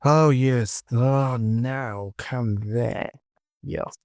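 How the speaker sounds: ordinary delivery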